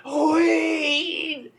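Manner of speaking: very high, loud, slow holler; imitated shouting seller; top-of-lungs shout. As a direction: stretch it out like a street hawker. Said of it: "Just top of his lungs".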